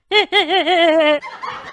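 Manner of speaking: a sudden put-on laugh on request; forced laugh. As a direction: switch from crying to a fake laugh. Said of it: "हंस देते हैं।". (रोने से अचानक बनावटी हंसी)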